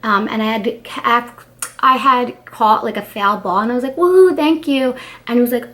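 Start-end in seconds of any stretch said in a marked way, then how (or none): 4.0-5.0 louder, higher whoop of joy; retold cheer. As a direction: cheer like you just caught the ball. Cheering after catching a foul ball.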